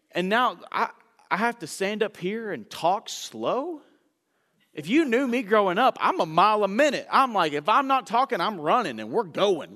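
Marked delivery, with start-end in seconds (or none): none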